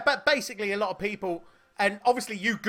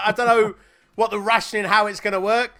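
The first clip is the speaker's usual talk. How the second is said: ordinary talk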